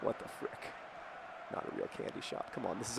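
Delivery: hushed whisper of indignation; muttered aside to himself, incredulous whisper; whispered disbelief. Real, not acted. Aside about fake candy shop.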